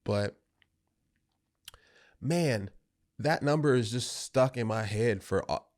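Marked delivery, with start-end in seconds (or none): none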